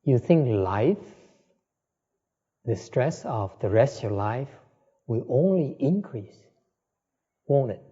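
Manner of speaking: usual speaking voice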